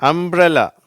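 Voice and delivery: அம்ப்ரெல்லா high and loud, m-b-r joined; ringing model word UMBRELLA; MBR blend demo. Shown as a demonstration. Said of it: "அப்ப எப்படி வருது".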